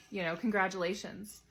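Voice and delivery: normal talking voice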